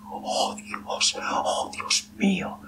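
slow, sighed-out despair; sighing. Acted. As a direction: sigh the words out slowly. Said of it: "suspiró Adelgunda".

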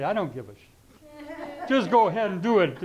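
loud, high-pitched mock rant; hammed-up angry swearing; retold angry outburst. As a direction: act out losing your temper, half amused. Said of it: "because I'm angry, I say".

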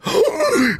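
sudden high frightened gasp; sharp gasp. Real, not acted. Streamer spotting a Lynel, sharp scared gasp. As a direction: gasp in alarm at the monster.

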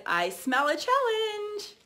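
high, slow, eager catchphrase voice; imitating Ashley. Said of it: "she always says".

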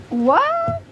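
high, loud startled what; sudden high what; surprised what. Real, not acted. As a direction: thrown by the meeting. Emergency meeting called.

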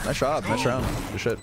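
ordinary talk

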